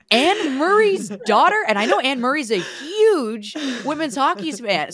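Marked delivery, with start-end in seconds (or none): 0.1-1.6 higher and louder, slow starstruck gush; fangirl excitement. Retold or imitated. Teammate recalling her starstruck reaction, excited and higher. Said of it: "am gonna fangirl over her mom".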